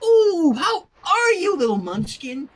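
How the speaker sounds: put-on high, loud baby talk; cooing. A narrator's reading. Aunt cooing to the toddler she lifts, high and loud. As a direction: coo up at the little one. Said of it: "she cooed".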